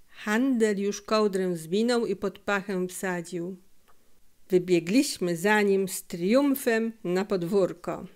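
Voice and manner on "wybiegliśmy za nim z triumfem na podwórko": plain reading voice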